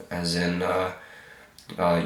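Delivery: ordinary talk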